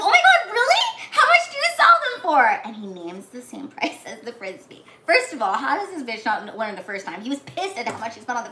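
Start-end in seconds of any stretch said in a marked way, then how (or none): 0.0-2.5 put-on very high, loud girlish squeal; hammed-up delight. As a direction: gush in a mock thrilled, high-pitched voice. Acts out girl's delight at keychains.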